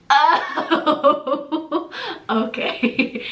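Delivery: laughing through the words; laughing realization. Real, not acted. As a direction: giggle it out, caught off guard. Realizes he is asking her out.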